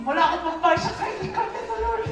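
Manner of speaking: fast, laughing complaint. Real, not acted. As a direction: laugh through the worry, rushing the words. Laughing about credit card debt.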